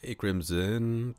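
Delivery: normal talking voice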